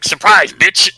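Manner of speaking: spring a gleeful villain surprise on him; mock villain gloating. Robotnik to Sonic before the wrecking ball, mock gloating.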